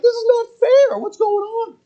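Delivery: put-on high, loud childish protest; outraged kid. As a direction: whine it like a cheated kid. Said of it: "I'm like".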